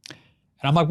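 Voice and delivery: usual speaking voice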